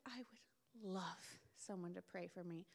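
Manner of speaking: slow tearful whisper. Retold or imitated. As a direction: whisper it through tears. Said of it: "She's like".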